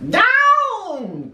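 high, drawn-out witch's holler; sudden high-pitched cry. A narrator's reading. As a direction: call it out high, falling at the end. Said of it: "cried the witch".

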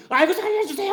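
high, pleading cry of a fleeing king; mock begging for life. Preacher acts the fleeing king begging enemy soldiers, high mock plea. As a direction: beg for your life in a hammed-up high wail.

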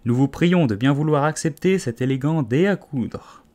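high, ceremonious speech-making; solemn presentation. A narrator's reading. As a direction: present it like a pompous official. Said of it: "présentait solennellement le dé".

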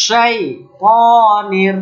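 very slow শাইতানির with a full-mouth sh; spread sh. Shown as a demonstration. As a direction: stretch শাইতানির, let the sh fill the mouth. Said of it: "শাই, দেখেন শুরু হচ্ছে".

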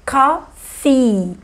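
"coffee" devagar, com o i final esticado; i final longo. Shown as a demonstration. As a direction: alongue o "ee" de "coffee". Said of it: "os dois E's longos". ("coffee" bem lento, terminando num i longo)

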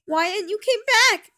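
high, loud, overjoyed cry; excited greeting. A narrator's reading. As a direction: call out in delighted welcome. Bendy runs over, hugs tightly.

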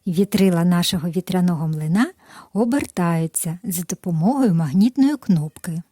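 usual speaking voice

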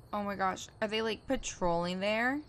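usual speaking voice

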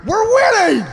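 high, whooping holler to a partner; mock jubilant gambler's shout; imitated excited calling out. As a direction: call over your wife in giddy triumph. Drunk gambler calls to wife.

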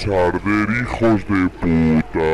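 low booming battle cry; deep villain voice. Pretend. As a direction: growl it like a brute.

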